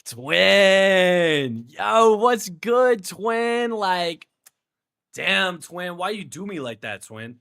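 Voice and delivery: loud, high, drawn-out bro greeting; imitating a bro friend. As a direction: hail them like an over-hyped bro, then ease down. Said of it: "Bro, he says".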